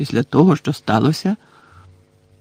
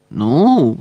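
mumbled; 3 dB louder than usual. A narrator's reading. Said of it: "промимрив Гаррі".

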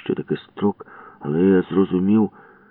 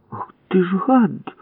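much higher, slow outraged cry; furious cry; 13 semitones higher, slower than usual. Acted. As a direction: spit the insult. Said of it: "крикнула Валька". (гнівний крик)

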